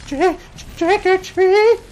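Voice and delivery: put-on high, timid little kid asking for candy. Scared kid asking grumpy dad for candy, high and timid. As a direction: squeak it out like a nervous small child.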